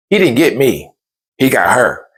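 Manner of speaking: usual speaking voice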